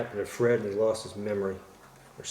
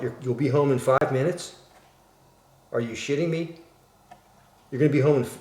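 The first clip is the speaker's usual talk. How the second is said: raised, louder incredulous questioning; acted alarmed disbelief; 4 semitones higher, 6 dB louder than usual. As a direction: react as if caught out by your wife. Acted panic at wife's imminent return.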